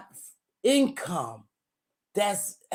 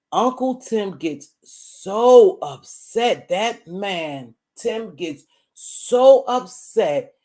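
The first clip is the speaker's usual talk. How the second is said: louder and slow, "so" stretched out; stress on so; 5 dB louder, much slower than usual. Real, not acted. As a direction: lean hard on "so upset". Emphatic complaint about Tim.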